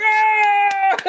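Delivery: very high, loud mock scream; crowd roar. Crowd reacts to man on drugs, loud imitated scream.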